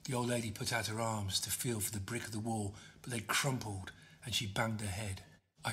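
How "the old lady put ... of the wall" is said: ordinary delivery, nothing marked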